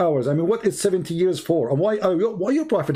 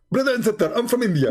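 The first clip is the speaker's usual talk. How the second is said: higher-pitched, lightly played Indian accent; 6 semitones higher than usual. Debater playing along with caller's accent remark, mock Indian accent. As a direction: claim to be Indian in a raised, accented voice. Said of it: "yeah, I'm an Indian".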